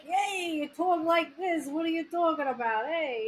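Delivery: put-on high, playful New York Italian accent. Host imitates guest's first reaction, playful New York Italian accent. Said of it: "kind of what New York Italian".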